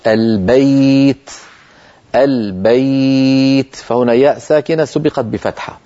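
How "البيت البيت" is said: البيت stretched very slowly, the ay held; slow demo. Shown as a demonstration. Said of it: "مثل إيش؟". (البيت ببطء مع إطالة الياء)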